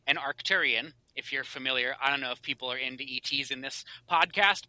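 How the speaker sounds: ordinary delivery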